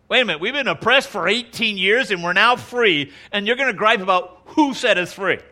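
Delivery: high, loud, exasperated comeback; indignant retort. Retold or imitated. Jephthah answering the griping Ephraimites, loud and indignant. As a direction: throw the ingratitude back at them.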